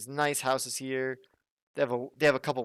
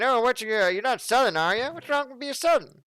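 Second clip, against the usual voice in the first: put-on Southern accent; 8 semitones higher than usual. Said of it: "southerner impression I'll make".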